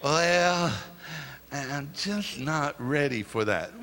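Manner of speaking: lightly voiced reluctant member; reluctant churchgoer. Preacher voicing someone not ready for praise, reluctant.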